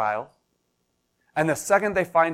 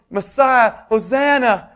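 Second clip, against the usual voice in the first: louder and higher, a festive cry of praise; crowd yelling praise; 9 semitones higher, 7 dB louder, slower than usual. Retold or imitated. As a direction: shout it like a cheering crowd. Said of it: "instead of yelling".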